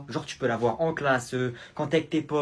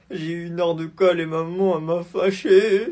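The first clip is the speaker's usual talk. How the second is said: put-on crying child; 3 semitones higher, 7 dB louder than usual. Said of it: "Pourquoi tu pleures?".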